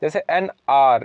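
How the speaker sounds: ordinary delivery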